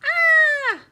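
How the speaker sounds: very high, loud sustained scream. A narrator's reading. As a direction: shriek it out at full pitch. Loud exclamation before hollering.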